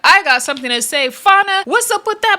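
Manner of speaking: high mock challenge to a rival; imitating Summer calling Fauna out; Summer confronting. Reality star calls out her castmate, high mocking challenge. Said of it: "Summer comes out of nowhere like".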